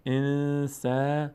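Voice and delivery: slow, drawn-out chanted Arabic recitation; slow Quran recitation. Shown as a demonstration. Teacher slowly reciting the verse for the student, chanted. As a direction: stretch every syllable as in tajweed. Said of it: "teacher recites verse for student".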